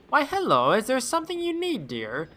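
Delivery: put-on high, sweet old-lady voice. Novelist lady greeting the detective in a high sweet voice. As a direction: coo like a kindly grandmother.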